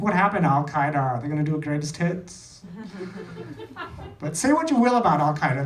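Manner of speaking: ordinary talk, nothing marked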